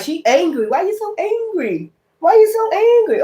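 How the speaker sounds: high mocking imitation of people asking why she's so angry; mocking critics. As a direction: squeal it back at them, mocking. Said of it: "they'll be like".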